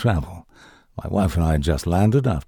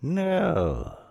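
put-on old witch voice; 11 semitones higher than usual. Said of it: "replied the witch".

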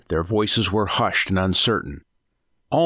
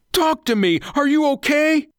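very high, urgent questioning; frantic pleading; 4 dB louder than usual. A narrator's reading. Husband checking on his silent wife, frantic. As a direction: plead in panic for an answer.

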